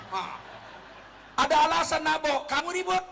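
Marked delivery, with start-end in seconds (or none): 1.4-3.0 loud, high outburst; mock quarrel. Husband answering a wife who fights back, acted anger. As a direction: burst out like a husband provoked. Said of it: "kalau dia ngelawan".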